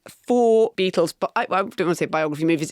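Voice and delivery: normal talking voice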